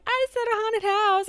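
high, excited mock boast; proud announcement. Telling her mom about haunted house, proudly squealed. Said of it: "I was so proud of myself".

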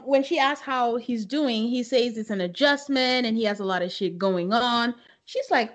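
ordinary delivery

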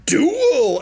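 high, drawn-out cry; comic high-pitched challenge; challenging creature. Acted. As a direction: declare it in a mock-dramatic high voice. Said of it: "they're like".

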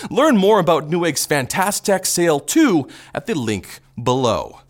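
ordinary talk, nothing marked

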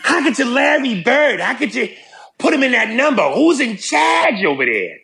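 put-on Boston accent. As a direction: overdo a Boston sports fan accent, high. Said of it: "my horrendous Boston accent".

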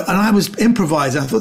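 ordinary talk

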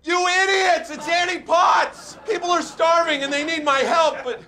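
high, loud exasperated shout from heaven; God losing patience with idiots; a high-pitched divine rant; God's exasperated voice. Retold or imitated. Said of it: "I picture him up in heaven watching us going".

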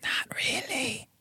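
slow, soft whispered ghost imitation; spooky EVP-style whisper; ghostly whisper. Repeats option as the ghost.

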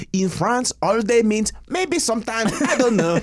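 put-on French accent, laughing at the end; mock French accent. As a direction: shrug it off in a fake French accent. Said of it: "we were doing the French accent".

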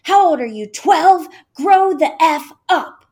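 put-on shouting down a rude girl in the security line; losing it. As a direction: blow up and tell her off. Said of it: "I went crazy".

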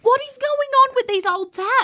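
high, fed-up complaint; exasperated. Real, not acted. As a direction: throw up your hands at it. Complaining about shower taps.

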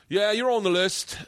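mock accented DJ voice; club DJ. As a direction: call it out as the club DJ. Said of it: "finally the D J comes out".

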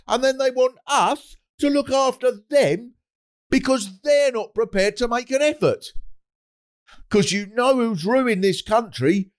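high-pitched indignant rant against boats; mock outraged ranter.

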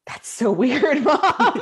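put-on high giggly kid voice through a laugh; laughing daughter quote. Mom imitating her daughter laughing at her, high and giggly. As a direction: laugh out the line as the amused little girl. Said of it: "she looked at me like".

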